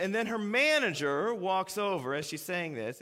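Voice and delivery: ordinary talk, nothing marked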